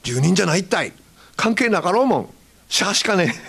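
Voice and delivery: Hakata retort. Retold or imitated. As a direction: snap back at him in Hakata dialect. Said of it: "相手は知らないおばさんで". (博多弁で言い返す)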